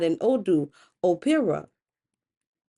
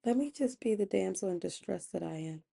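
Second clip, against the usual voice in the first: much softer, limp damsel in distress; helpless damsel; 10 dB quieter than usual. Acted. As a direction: play helpless in a small quiet voice. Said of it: "looking helpless".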